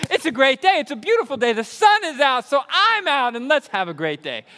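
loud, high, gushing cheer; relentlessly sunny morning pep; overly chipper. Shown as a demonstration. [name] waking people at seven AM, annoyingly chipper. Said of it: "I'm highly annoying at seven A M".

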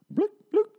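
tiny, very high cartoon blips; blink sound effect. Reviewer mimicking the film's blink effects, high cartoon blips. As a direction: squeak out two little blinks. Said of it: "They literally do sound effects when he blinks".

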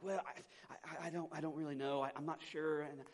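soft, halting, unsure mumble; stammering. Retold or imitated. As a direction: imitate someone fumbling for an answer, quietly. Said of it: "people kinda stammer around".

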